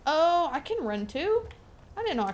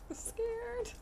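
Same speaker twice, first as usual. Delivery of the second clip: tiny high mock-scared squeak; squeaky scared; 12 semitones higher, 9 dB quieter than usual. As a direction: squeak it out like a frightened kid. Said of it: "That was a great impression".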